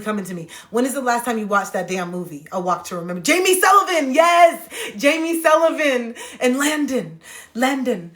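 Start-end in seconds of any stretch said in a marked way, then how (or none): 3.2-8.1 excited. Real, not acted. Excited recalling movie characters.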